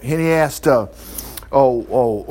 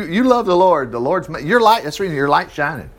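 ordinary talk

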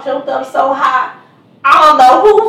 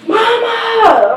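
calling out; 9 semitones higher than usual. Retold or imitated. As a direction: call her name up the stairs. Son calls mom from downstairs.

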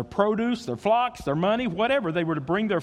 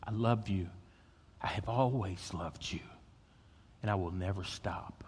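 soft, low and tender; lower, much softer divine reassurance; hushed God's voice; 10 semitones lower, 11 dB quieter than usual. Acted. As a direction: speak it gently like a quiet promise. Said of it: "hear God saying to you".

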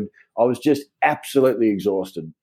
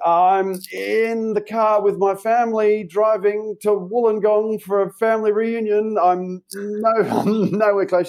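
fake innocent excuse; 11 semitones higher, slower than usual. Lying to the boss on phone.

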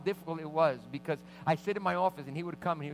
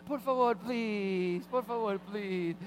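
slow, higher drawn-out begging; drawn-out kid plea; 6 semitones higher, much slower than usual. Retold or imitated. Boy on the pastor's lap, slow drawn-out pleading. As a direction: stretch each word like a whining child.

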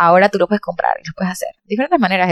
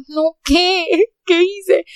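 confused alarm; 9 semitones higher, much slower than usual. Retold or imitated. Retold confusion at restaurant.

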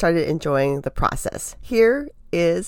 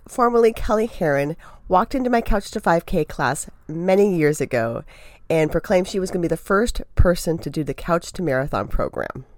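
ordinary delivery

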